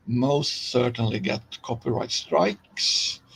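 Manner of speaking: normal talking voice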